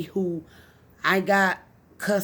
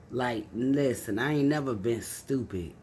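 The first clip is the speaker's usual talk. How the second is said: usual speaking voice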